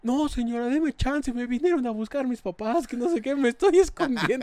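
put-on high, pleading girl's voice; desperate girl. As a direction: beg the lady for a break. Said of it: "la morra bien desesperada". (muchacha desesperada suplica a la señora, aguda y suplicante)

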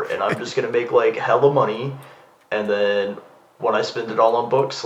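ordinary talk

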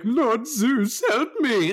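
high, loud, whiny cry for help; squealing mock plea to Zeus; mock panicked plea. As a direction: ham up a cowardly enemy begging his god. Mocking enemy begging Zeus.

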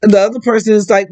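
ordinary delivery

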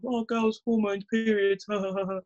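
mock the tired line about girls and hormones; mocking cliché. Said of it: "don't like that narrative of".

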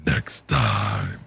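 very low, menacing villain growl; Doctor Claw voice. Retold or imitated. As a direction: threaten like a cartoon villain. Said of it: "same voice for Doctor Claw".

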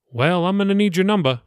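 a hint of a cheerful pastor; pastor Billy. The pastor says goodbye to Jacob, lightly voiced and friendly.

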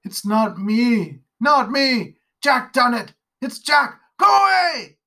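a high, loud, rising scream that breaks into crying; a nightmare-stricken father shrieking for his life; raving in terror. Acted. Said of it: "hear her father raving again".

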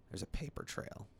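hushed, ominous reveal; dramatic whisper. Acted. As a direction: whisper it like a secret.

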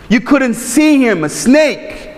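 usual speaking voice

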